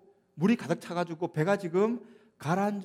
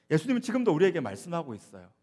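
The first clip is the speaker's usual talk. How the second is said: normal talking voice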